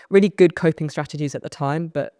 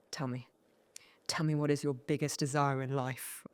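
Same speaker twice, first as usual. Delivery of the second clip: intense stranger; 10 dB quieter than usual. Retold or imitated. Intense guy leaning in to a nervous newcomer, soft and probing. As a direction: lean in and ask it too earnestly.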